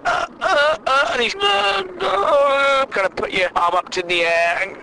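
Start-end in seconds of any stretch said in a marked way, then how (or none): 0.1-2.8 high strained gasping uhs; clutching-chest fake gasps, pitched high; mock soap heart attack. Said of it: "heart attacks they have on soap operas".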